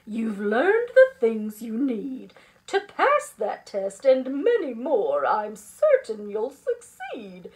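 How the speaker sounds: loud, emphatic teacher voice; rally the class with conviction; hearty, assured pep talk; confident encouragement. Acted. Teacher rallies the worried class.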